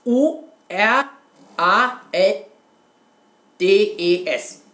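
spelling out "Uraldes" for the viewers, letter by letter; spelled out letter by letter. Shown as a demonstration. Explaining a typo to viewers, spells "U R A L D E S" letter by letter. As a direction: spell "U R A L D E S" letter by letter, each one separate. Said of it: "was bedeutet das Wort Uraldes".

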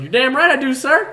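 gleeful shouted taunt at the preacher; taunting yell. Retold or imitated. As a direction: holler back to provoke the preacher. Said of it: "I was like".

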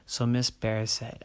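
ordinary delivery, nothing marked

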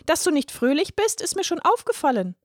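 sing-song and high; high fluting girl's voice; fluting little girl. A narrator's reading. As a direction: trill it sweetly like a cheeky girl. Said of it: "flötete die Kleine".

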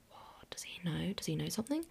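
hushed, whispered aside to oneself; panicked whisper. Retold or imitated. Daughter wondering if dad found out, panicked whisper. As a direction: whisper it like a secret suspicion.

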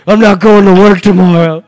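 loud, high imitation of drunken weeping; mock weeping. Retelling how he drunkenly gave up on work, mock weeping. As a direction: blurt it out as if wasted and weeping. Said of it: "didn't go to work and just wept".

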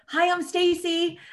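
high, slow, chirpy hi; bubbly greeting. Shown as a demonstration. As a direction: brighten up like a friendly bubbly intro. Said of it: "you can always be bubbly".

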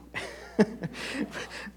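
short breathy chuckle; laughing. Real, not acted. Preacher amused at promising only two scriptures, chuckling. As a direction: chuckle lightly under the breath.

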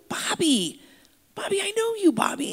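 high-pitched delighted calling of a name; excited recognition. Retold or imitated. Pastor greeting the inmate he knows, excitedly. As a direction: call out to a long-lost acquaintance. Acts out joyful recognition of Bobby.

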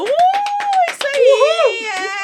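high, loud, slow cheer. Retold or imitated. Cheering the priest at mass as a kid, loud slow whoop. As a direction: whoop it up like a rowdy fan. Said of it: "e eu".

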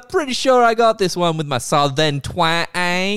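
put-on drawn-out, high and loud Southern accent; hammed-up Southern drawl, slow and confident; Southern twang. Star insisting on her Southern accent, cocky mock Southern twang. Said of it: "I'm gonna do a Southern accent".

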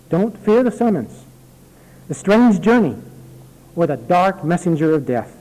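put-on earnest reading of an old letter; letter read aloud. Pastor reading a dying aunt's letter aloud, earnest reading. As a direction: read it out heartfelt to the congregation.

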